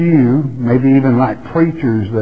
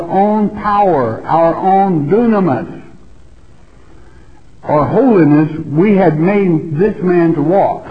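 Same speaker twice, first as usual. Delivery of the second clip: scripture reading; 4 semitones higher than usual. Acted. Preacher reading Peter's address to Israel, raised and declaiming. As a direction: proclaim the old text like an orator. Reads Peter's speech aloud.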